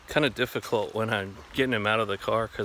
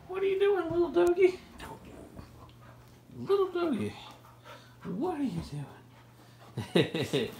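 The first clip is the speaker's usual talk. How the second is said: talking to a dog. Real, not acted. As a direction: coo at the puppy in a high voice. Talking to his dog Keiko.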